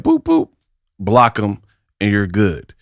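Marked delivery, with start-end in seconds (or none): 0.0-0.5 high squeaky "Boop, boop"; mock button-press boops; playful boop sounds. Shown as a demonstration. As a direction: make a cartoon blocking noise. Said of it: "Just go like".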